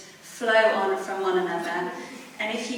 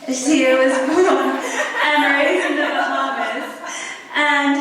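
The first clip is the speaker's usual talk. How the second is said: louder and higher, laughing through the words; bubbling laugh through her intro; laughing while speaking; 6 semitones higher, 8 dB louder than usual. Real, not acted. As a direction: let a real laugh break into the introduction.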